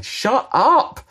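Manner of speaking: put-on a child's tantrum yell, pitched high; mock child scream. Screaming child shouting at parent, mock yell. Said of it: "still screaming and saying".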